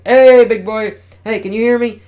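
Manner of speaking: high, loud hollering to rouse him; calling out loudly. Acted. Spidey hollers loudly to wake the sleeping giant.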